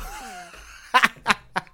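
high, breaking burst of laughter; amused laugh. Real, not acted. Vlogger reads about a texting boyfriend, amused laugh. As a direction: crack up at what you just read. Laughs at viewer's submitted comment.

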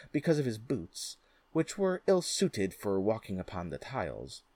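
ordinary delivery

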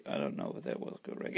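quick whispered aside to self. Real, not acted. Aside under the breath.

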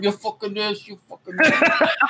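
lightly voiced cursing rock star impression; hint of a mock English rocker. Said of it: "Does she sound like [name]?".

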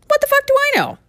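very high-pitched squeaky punchline; comic exclamation. Acted. Catchphrase repeated as comic punchline.

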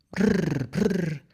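high trilled shiver of cold; shivering brr. A narrator's reading. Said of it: "La ovejita tiritaba de frío". (tiritando de frío)

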